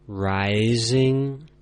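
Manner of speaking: "rising," with the pitch sliding upward. Shown as a demonstration. Teacher showing learners the second tone, voice climbing. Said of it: "And one more time".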